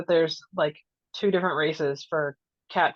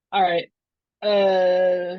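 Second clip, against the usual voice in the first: ordinary talk, nothing marked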